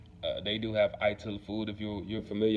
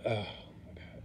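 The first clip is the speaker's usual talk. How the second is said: whispered oh my God, quiet and breathy; hushed shock at the chicken in the patty; whispered dismay; 8 dB quieter, much slower than usual. Retold or imitated. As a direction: whisper it in disbelief. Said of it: "so it's like".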